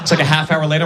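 normal talking voice

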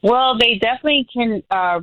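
normal talking voice

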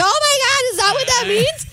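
high, squeaky mock shock; imitating Monica's high-pitched horror. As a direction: gasp it out in a high surprised voice. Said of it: "And she's like".

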